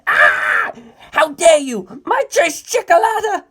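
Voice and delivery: put-on high, loud, furious shriek of a teen; high piercing outraged shriek; shrieking outrage. A narrator's reading. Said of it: "Narancia screamed".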